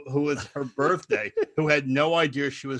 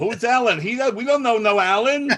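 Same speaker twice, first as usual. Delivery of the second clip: high, loud, amused imitation; mock indignation; 9 semitones higher, 6 dB louder than usual. Storyteller echoing guests who don't know the entertainer, mock indignation. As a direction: imitate a baffled guest complaining.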